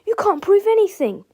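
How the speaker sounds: high-pitched defiant cry; George. A narrator's reading. George challenges the principal, crying out. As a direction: protest loudly and high. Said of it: "cried George".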